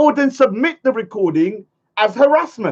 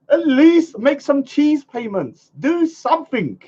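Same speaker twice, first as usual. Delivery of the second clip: usual speaking voice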